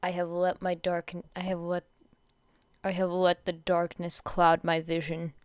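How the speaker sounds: a lightly voiced gloomy dark-hero intonation; brooding growl. A narrator's reading. Tokoyami lamenting being hit, low brooding voice. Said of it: "he growled".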